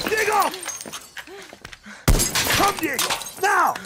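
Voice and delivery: desperate shouted summons; frantic calling. Acted. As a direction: yell for your son across chaos. Calling son during attack.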